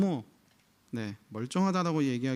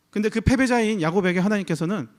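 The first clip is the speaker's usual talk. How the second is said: louder, higher preaching push; preacher raising the voice to declare the winner; emphatic preaching; 5 semitones higher, 7 dB louder than usual. Acted. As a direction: drive the point home from the pulpit. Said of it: "preacher's emphatic climax".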